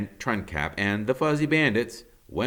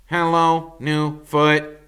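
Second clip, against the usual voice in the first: robot greeting; 7 semitones higher, 8 dB louder, slower than usual. Acted. Robot greets the wooden foot.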